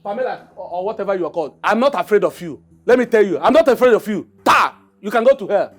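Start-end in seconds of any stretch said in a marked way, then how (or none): 1.6-5.7 loud, rising defiance; defiant shouting. Acted. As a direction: throw it in her face. Defiant outburst at the queen.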